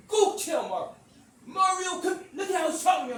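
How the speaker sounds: high, loud mock yell; imitating an indignant daughter hollering; outraged calling out. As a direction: shout for a witness, scandalized. Acting daughter calling for backup.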